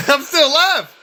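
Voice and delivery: high, loud comic outburst; hammed-up indignant cry after a laugh; mock indignant. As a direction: protest loudly, imitating an outraged comedian. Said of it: "He's so mad".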